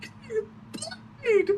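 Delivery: high teary squeal, slowed; a hint of a sob; mock crying. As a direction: imitate the wife weeping with joy. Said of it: "She started crying".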